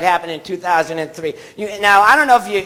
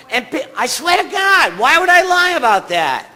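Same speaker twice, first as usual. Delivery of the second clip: insistent near-shout; 8 semitones higher than usual. Real, not acted. Comic swearing to the crowd it's true, insistent near-shout. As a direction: pitch up high, insisting he's not lying.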